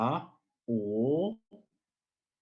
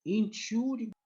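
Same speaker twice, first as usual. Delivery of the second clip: high model word; 12 semitones higher than usual. Shown as a demonstration. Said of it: "I know it's a bit hard to pronounce".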